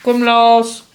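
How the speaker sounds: loud call to dog. Real, not acted. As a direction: call the dog across the room. Calling the dog.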